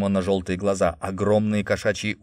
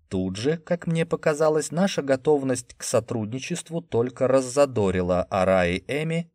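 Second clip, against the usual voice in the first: usual speaking voice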